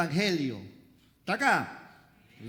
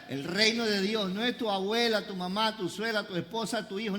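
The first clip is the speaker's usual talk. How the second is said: usual speaking voice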